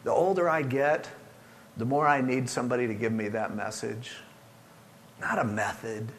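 ordinary talk, nothing marked

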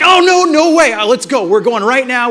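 loud, high burst of retold resolve; urgent insistence. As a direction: leap in loudly to rescue the situation. Dad rushing to fix everything.

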